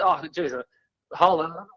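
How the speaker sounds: ordinary delivery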